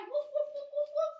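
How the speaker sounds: high, loud, rapid woofs; playful dog bark. Pretend. Teacher acting out the dog for kids, high barking. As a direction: yap like an excited little dog.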